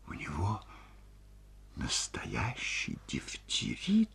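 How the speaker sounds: slow, frightened-sounding hushed question; whisper. A narrator's reading. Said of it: "спросила шёпотом Ольга Ивановна". (медленным шёпотом)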